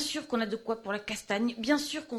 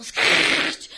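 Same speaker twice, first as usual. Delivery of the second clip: hissed shout; 11 dB louder than usual. Acted. Hissed cry mid-speech.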